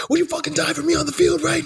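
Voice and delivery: high intense locker-room rallying cry; Ray Lewis hype speech. Retold or imitated. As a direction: fire up the team, way up high. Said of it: "that classic Ray Lewis".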